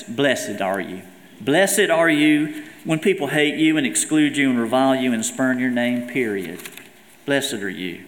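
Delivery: a hint of a Southern church lady saying "Blessed are you"; Southern blessing. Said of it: "like in that southern sense".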